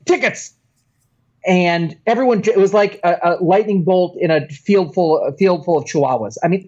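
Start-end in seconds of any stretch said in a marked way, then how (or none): 0.0-0.5 high, sudden mock demand; stern police officer. German police officer demanding tickets on the train, sharp. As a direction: call it out like a German ticket inspector. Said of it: "German police officers get on the train saying".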